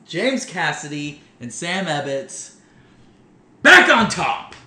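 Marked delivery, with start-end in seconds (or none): 3.6-4.5 hype announcer. Pretend.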